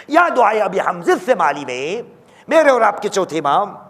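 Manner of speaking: ordinary talk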